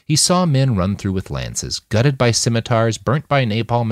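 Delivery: ordinary delivery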